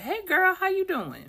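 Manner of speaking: put-on very high bright girlfriend greeting; perky greeting. Greeting a friend she ran into, very high perky voice. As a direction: squeal hello to an old friend. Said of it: "and you're like".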